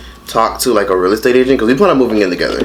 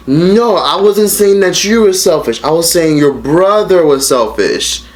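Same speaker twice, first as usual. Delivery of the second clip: defensive mom; 7 semitones higher, slower than usual. Retold or imitated. Mother voiced in retold call.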